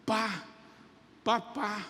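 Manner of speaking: lightly voiced toddler saying papa; soft baby babble. A baby calling its father over the monitor, softly.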